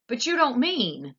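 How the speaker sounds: high, loud protest; alarmed protest; worried shout. A narrator's reading. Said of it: "shouted Grandpa Joe".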